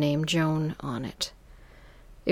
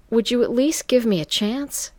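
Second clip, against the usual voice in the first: higher, louder desperate plea; pleading; 7 semitones higher, 5 dB louder than usual. Acted. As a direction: beg for the chance. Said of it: "I pleaded".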